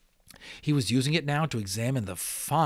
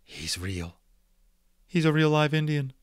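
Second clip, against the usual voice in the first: croaked; 6 semitones higher, 5 dB louder than usual. A narrator's reading. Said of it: "and croaked out".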